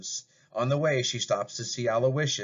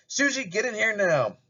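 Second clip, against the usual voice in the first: much higher, calling shout; angry shout; 10 semitones higher, 4 dB louder than usual. Acted. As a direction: yell for her daughter from the door. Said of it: "bursts in the door and shouts".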